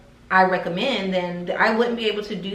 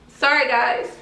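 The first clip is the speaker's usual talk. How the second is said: tearful apology; 5 semitones higher, 5 dB louder than usual. Real, not acted. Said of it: "I don't like crying on camera".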